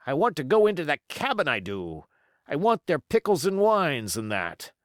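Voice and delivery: itching for the cabin's wine; impatient pirate voice. A narrator's reading. Israel Hands, impatient.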